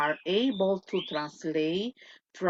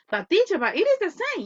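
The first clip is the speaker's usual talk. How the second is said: mock student protesting; 9 semitones higher, 4 dB louder, faster than usual. Teacher voices a student's objection.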